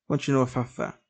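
ordinary delivery

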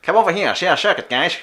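high, loud shady showman; sketchy circus barker. Acted. Host calling guys over to the circus, sketchy barker shout. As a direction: holler them over like a dodgy ringmaster.

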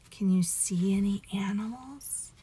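ordinary delivery

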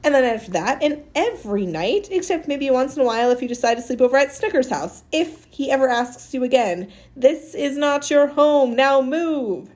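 bossy ordering. Acted. Amanda orders Maniac to come home with her, fierce insistent command. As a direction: lay down the law, stressing word after word.

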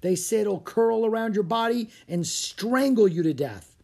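high-pitched, lightly shuddering horror tale. A narrator's reading. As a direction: spook the listener with a grisly warning. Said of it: "said Tommy with a shudder".

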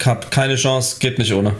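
ordinary talk

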